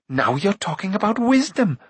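high-pitched acted exclamation; indignant cry. A narrator's reading. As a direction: pounce on him, pitched well up. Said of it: "Eilonwy cried".

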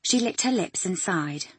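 usual speaking voice

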